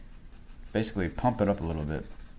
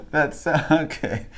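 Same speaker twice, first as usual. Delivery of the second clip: laughing; 8 semitones higher, 5 dB louder, slower than usual. Real, not acted. Still laughing after joke.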